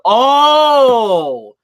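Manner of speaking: sudden loud high oh of realization; surprised oh. Real, not acted. Streamer finally gets the Alberta story, loud surprised oh. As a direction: burst out as it finally clicks.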